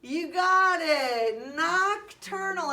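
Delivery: loud, high, drawn-out celebration; excited praise. Real, not acted. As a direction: sing out the big reveal slowly. Teacher celebrates a child's right answer.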